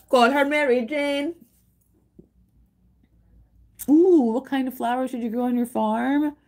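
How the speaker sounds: high and loud, sing-song game prompt; bright high cartoon narrator; chirpy game voice. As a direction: chirp it like a cute farm game.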